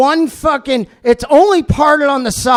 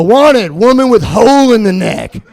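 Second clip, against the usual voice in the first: ad read; 6 dB louder than usual. Retold or imitated. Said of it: "how's that audition go".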